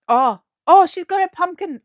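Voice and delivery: loud, high burst of surprise; sudden cry of delight at a friend's pumpkin photo; surprised delight. Real, not acted. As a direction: react with a sudden happy cry.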